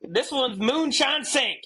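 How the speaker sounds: high, slow mock Southern drawl; hammed-up Southern twang. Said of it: "in a southern accent".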